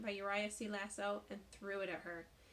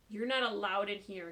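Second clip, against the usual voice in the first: cold; 8 dB louder than usual. Acted. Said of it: "he said coldly".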